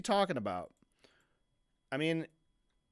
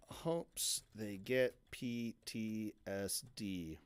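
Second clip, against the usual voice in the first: slow incredulous repeat; 7 semitones lower, 8 dB quieter, much slower than usual. Retold or imitated. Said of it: "repeating quote".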